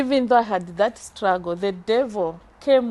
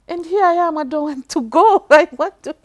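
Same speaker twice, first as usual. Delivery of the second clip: emotional; 7 semitones higher, 8 dB louder than usual. Real, not acted. Telling of her children, overcome with emotion. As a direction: voice rising with real emotion, on the edge of tears. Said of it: "I just become so emotional".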